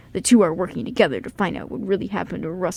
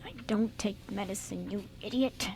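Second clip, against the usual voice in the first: much softer, muttered insult; frustrated mutter under the breath; 12 dB quieter than usual. Acted. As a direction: mumble it so only he hears. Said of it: "mumbling under his breath".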